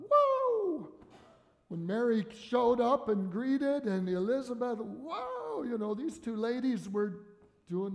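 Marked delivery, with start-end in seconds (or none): 0.1-0.9 whoa soaring high and loud; sudden high excited whoa. Acted. Describing excited women meeting. 4.8-5.6 excited whoa. Acted. Elizabeth's excited greeting.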